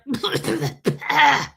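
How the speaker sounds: a short embarrassed laugh. Real, not acted. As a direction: laugh at yourself. Laughs at own story.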